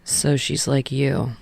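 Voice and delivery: easy smile. A narrator's reading. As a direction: tease him gently through a smile. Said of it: "with an easy smile".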